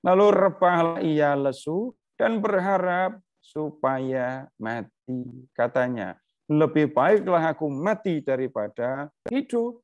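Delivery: solemn scripture recital; scripture reading. A narrator's reading. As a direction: read the verse slowly and gravely, lifting the pitch. Reads scripture aloud.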